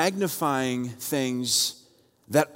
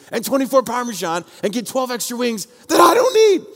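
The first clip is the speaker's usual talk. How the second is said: higher, louder, fed-up order; frustrated; 10 semitones higher, 7 dB louder than usual. Retold or imitated. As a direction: give in but let the irritation show, higher. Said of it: "really frustrated".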